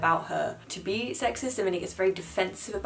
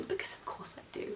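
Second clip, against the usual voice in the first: dropped to a whisper, self-mocking; whispered aside; 14 dB quieter than usual. Real, not acted. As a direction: tuck it away as a sheepish confession. Self-mocking aside about Goodreads.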